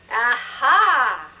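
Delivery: usual speaking voice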